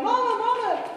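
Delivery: put-on high-pitched little kid calling for mama; child yelling. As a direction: call out like a kid running upstairs. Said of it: "came running upstairs yelling".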